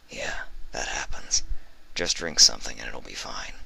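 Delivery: a soft, breathy amused reply; chuckling. A narrator's reading. Skolder to mute Vinyl, amused. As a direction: reassure her with a chuckle. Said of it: "Skolder chuckled".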